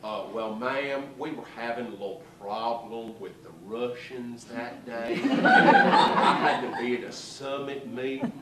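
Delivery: an apologetic president; Lyndon Johnson. Retold or imitated. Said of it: "L B J".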